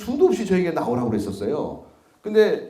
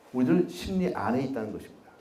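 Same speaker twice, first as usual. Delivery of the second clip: ordinary delivery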